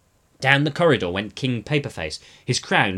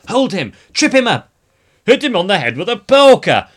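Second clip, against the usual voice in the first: high, loud chasing-mob shouts; 9 semitones higher, 12 dB louder than usual. A narrator's reading. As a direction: bellow orders in the thick of a chase. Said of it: "echoed to the shouts".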